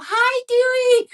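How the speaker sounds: mom's high cooing hello to her tall son; high sing-song greeting. Retold or imitated. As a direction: coo his name up high like greeting a baby. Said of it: "Like that".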